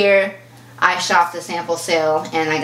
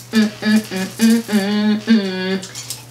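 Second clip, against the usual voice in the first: savoring hum; much slower than usual. Real, not acted. Savoring the arrived box.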